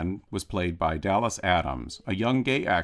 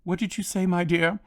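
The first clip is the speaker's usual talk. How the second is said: put-on puzzled old gentleman, much higher; lightly voiced genteel old actor, bemused; Sir Ralph; 11 semitones higher than usual. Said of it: "The response from Sir Ralph was".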